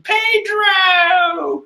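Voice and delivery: high anguished cry of a name; yelling name. Acted. Rabbit girl crying out for her fallen friend, high wail. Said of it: "Carrot is yelling".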